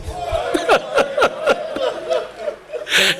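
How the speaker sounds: high unvoiced crying wail; wordless wail. Real, not acted. Preacher weeping over the child, high wordless wail. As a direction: let a high cry break out.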